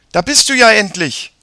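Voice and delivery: put-on high, loud girl's greeting; impatient sister. A narrator's reading. Sister greets brother arriving late, impatient. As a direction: greet him as long overdue.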